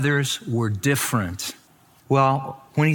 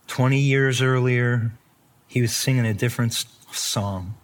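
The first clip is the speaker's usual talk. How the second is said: normal talking voice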